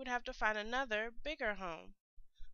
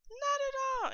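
much higher, louder chirpy reply; cheerful pebbles; 7 dB louder than usual. A narrator's reading. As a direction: pebbles happily agreeing. Pebbles answer the crab.

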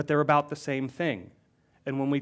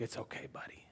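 softer whisper, close and gentle; whispered comfort; 3 semitones lower, 19 dB quieter, faster than usual. Acted. God comforting the grieving pastor, whispered. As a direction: reassure a hurting friend in a whisper. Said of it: "It's like".

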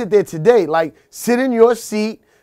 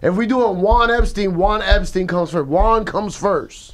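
ordinary talk, nothing marked

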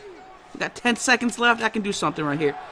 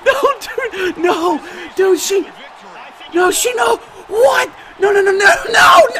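high, loud panicked nos; panicked scream; 10 semitones higher, 6 dB louder than usual. Real, not acted. Gamer screaming no as he gets choked out. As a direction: scream as it slips away.

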